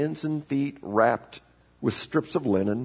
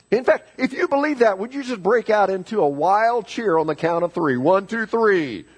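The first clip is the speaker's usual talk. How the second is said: high, rallying preacher's call; excited count-in to a cheer; rousing the congregation; 7 semitones higher, 4 dB louder than usual. Real, not acted. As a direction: whip the crowd up for a cheer.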